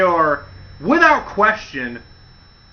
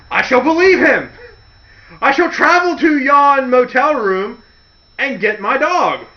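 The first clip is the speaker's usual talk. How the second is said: higher, louder mock-gallant hero; mock courtly hero, higher and louder; mock noble knight; 7 semitones higher, 5 dB louder than usual. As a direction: ham up a trusting noble fool. Voicing naive Al Snow.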